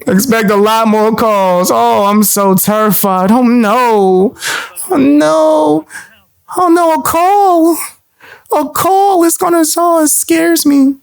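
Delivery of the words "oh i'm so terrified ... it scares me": high, slow hammed-up fear; mock fright. Taunting a rival about calls, high mock fear. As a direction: fake being scared, slowly.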